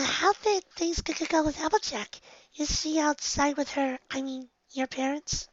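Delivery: very high, soft, stammering; timid Fluttershy voice. Acted. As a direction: speak shyly, barely daring.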